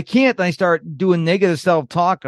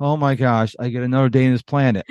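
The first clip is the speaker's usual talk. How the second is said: gloomy; 6 semitones lower, faster than usual. Retold or imitated. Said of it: "some people wake up and go".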